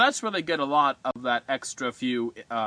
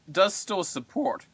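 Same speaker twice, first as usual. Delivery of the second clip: voice cracking; 6 semitones higher than usual. Real, not acted. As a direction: voice cracks up high mid-phrase. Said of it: "my, my voice cracked there".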